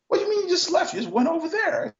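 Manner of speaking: mock the guy's confusion lightly; puzzled executive. Executive asking him why he walked away, mildly incredulous.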